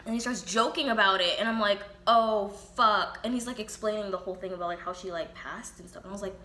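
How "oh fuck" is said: shocked outburst. Retold or imitated. Reaction to him joking about death.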